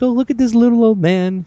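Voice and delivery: put-on high, loud cooing over a sweet old man; mocking gushing TV voice. Mocking how TV fawns over the little old man, high and gushing. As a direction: gush sarcastically like an adoring TV host. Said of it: "they're like".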